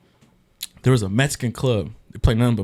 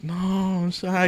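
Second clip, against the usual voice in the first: mock shy; 9 semitones higher, much slower than usual. Mock shy reply in retold scene.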